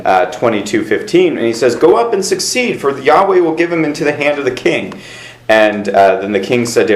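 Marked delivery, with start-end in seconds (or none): 1.8-5.0 high, mock-cheerful prophecy; sarcastic. Prophet mocks the king with a sarcastic, higher reply. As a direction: sell a false promise with a sneer. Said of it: "responds in a sarcastic way".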